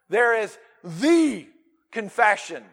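THE stretched, pause before "confession"; slow, momentous THE; stressed THE. Shown as a demonstration. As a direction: lean long on THE. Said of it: "the emphasis on this".

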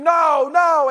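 high, loud imitated scream of a possessed girl; retold screaming. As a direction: shout it back like she did in the video. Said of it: "she would scream".

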